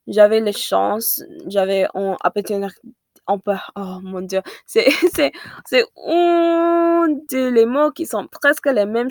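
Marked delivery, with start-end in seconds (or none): none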